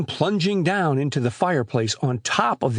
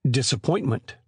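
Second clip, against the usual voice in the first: ordinary delivery, nothing marked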